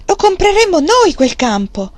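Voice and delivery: very high and loud, thrilled; eager husband shouting his plan; excited cry. A narrator's reading. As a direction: shout it out with glee.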